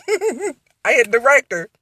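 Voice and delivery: giggling it out, slow and high; laughing. Real, not acted. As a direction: laugh through the news of the promotion. Laughing over her promotion news.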